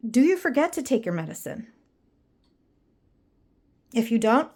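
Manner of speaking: ordinary talk, nothing marked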